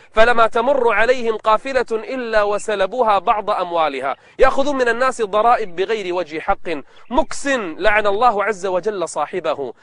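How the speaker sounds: plain reading voice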